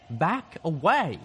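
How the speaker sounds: loud, high, drawn-out warning; trembling shout. A narrator's reading. Gutshot warns off the comrades blocking his shot, desperate shout. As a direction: shout them off, slow and desperate. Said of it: "Gutshot shouted, trembling".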